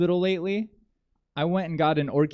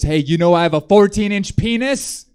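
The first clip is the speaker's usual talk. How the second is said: louder, higher, a hammed-up angry boast; mock angry brag; 6 semitones higher, 8 dB louder than usual. Creep trying his trump card on her, mock angry brag. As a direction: bark the brag like a desperate threat. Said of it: "look so angry".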